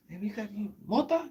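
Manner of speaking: high put-on voice, asking; high-voiced street customer feeler; acted buyer. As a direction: pitch it way up for the buyer. Acting out a drug buyer's approach.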